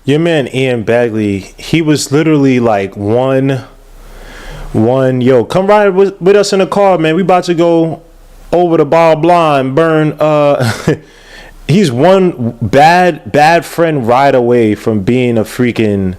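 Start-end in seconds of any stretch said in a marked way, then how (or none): 5.2-10.1 mock mafioso. Host imagining the reporter as a gangster, mock mafioso. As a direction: put on a hammed-up gangster voice. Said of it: "I can't even do the freaking voice".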